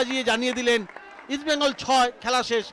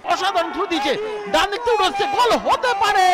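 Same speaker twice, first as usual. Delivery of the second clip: building excitement; 8 semitones higher, 5 dB louder than usual. Real, not acted. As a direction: pitch and volume climbing. Commentary building to a chance.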